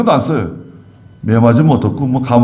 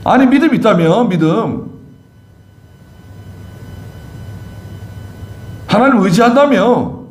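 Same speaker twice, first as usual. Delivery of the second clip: higher, exasperated, nagging questions; exasperated scolding; 5 semitones higher than usual. Acted. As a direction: scold them for their fear. 제자들을 나무라는 예수님 재연. (제자들을 다그치는 답답한 말투)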